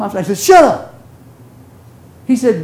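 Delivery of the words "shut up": higher and louder playful retort; mock shout. Preacher shouting back at his friend, playful mock yell. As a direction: fire it straight back.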